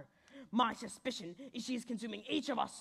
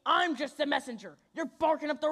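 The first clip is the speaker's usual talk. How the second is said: higher, louder protest; defensive shout; 5 semitones higher, 6 dB louder than usual. Acted. Detective dog to angry pets, loud protest. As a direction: push back at the angry crowd.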